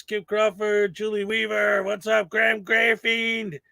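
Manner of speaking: usual speaking voice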